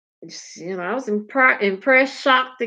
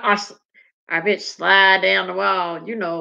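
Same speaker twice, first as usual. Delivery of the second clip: normal talking voice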